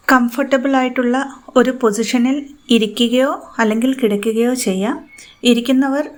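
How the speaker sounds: measured meditation voice with long pauses; unhurried, evenly pitched guiding, phrase by phrase; guided meditation. Real, not acted. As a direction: guide listeners gently through breathing and visualisation.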